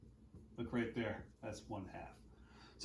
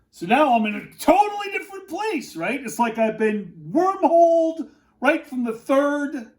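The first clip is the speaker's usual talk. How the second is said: excited; 9 semitones higher, 5 dB louder than usual. Real, not acted. Excited wormhole reveal.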